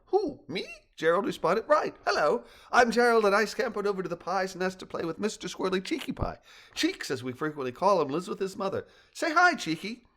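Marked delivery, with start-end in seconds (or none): 0.1-0.6 a much higher, small surprised pet voice; squeaky dog voice. Acted. Dog character asked to speak. 1.7-10.0 higher, bouncy little dog, quick and chatty; a cheerful kitty dog showing off his friend; a lightly higher pup voice, friendly; eager dog voice. Acted. Dog character introduces himself.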